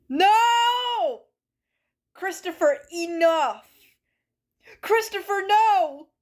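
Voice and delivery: high, loud hammed-up yelling; mock outraged shout. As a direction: scold him like a wounded drama queen. Mock outrage at chat's words.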